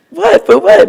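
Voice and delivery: high, sharp incredulous question; mock shocked what; surprised retort. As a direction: fire it back in disbelief. Wife's retold surprised reply.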